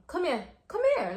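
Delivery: repeated command rising sharply in pitch; retold insistent beckoning. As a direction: imitate him impatiently calling her over. Man urging her to come, retold.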